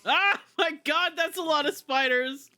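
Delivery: high-pitched spider panic; high panicked. Real, not acted. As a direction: freak out at the spiders. Many spiders in game.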